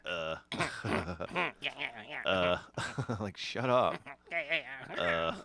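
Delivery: Butthead. Acted. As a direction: grunt and chuckle like Butthead. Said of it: "we be Beavis and Butthead".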